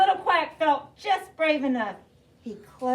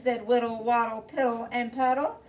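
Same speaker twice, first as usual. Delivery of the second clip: ordinary talk, nothing marked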